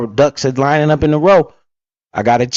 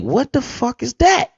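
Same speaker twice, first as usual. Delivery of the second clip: much higher, hushed shocked question; stunned disbelief; 9 semitones higher, 5 dB quieter than usual. Retold or imitated. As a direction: gape at the banana rack. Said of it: "I'm like".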